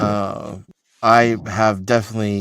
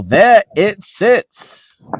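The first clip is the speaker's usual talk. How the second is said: slow dramatic delivery; 10 semitones higher, 4 dB louder, much slower than usual. Pretend. Dramatic sci-fi line, slowed.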